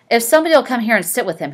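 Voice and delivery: quick, loud snapped order; authoritative snap. A narrator's reading. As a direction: bark the order fast, in full command. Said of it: "he snapped authoritatively".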